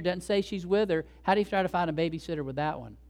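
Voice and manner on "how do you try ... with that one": usual speaking voice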